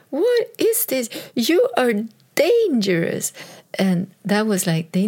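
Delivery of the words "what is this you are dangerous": put-on high, startled children's voice; lightly mocking alarmed kids; kids' shocked reaction. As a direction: mimic the kids, high and incredulous. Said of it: "they were like".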